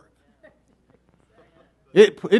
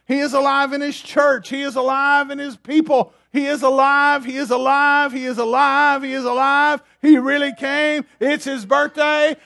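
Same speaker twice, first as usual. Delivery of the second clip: building, insistent sermon proclamation on a raised pitch; impassioned preaching; 4 semitones higher than usual. Real, not acted. Preacher proclaiming the risen Lord to his congregation, impassioned preaching. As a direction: drive each short phrase home like a revival preacher.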